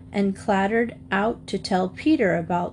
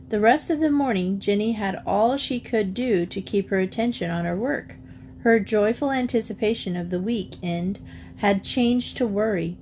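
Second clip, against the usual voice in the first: usual speaking voice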